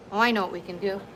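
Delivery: ordinary talk, nothing marked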